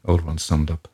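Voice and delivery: plain reading voice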